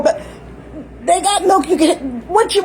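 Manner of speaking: usual speaking voice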